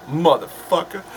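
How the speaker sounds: half-hearted tough-guy catchphrase; horrible Samuel L. Jackson. Acted. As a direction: toss off a bad celebrity impression. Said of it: "horrible Samuel L. Jackson impression".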